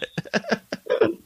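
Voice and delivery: sudden high-pitched laugh. Real, not acted. Laughs at the suspension joke.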